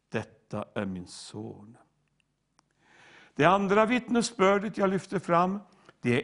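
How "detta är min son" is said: low, soft, lightly voiced fatherly declaration; hushed, lower hint of a divine father; Father's voice. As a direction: speak quietly as a revealed father, gently solemn. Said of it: "uppenbaras Faderns röst".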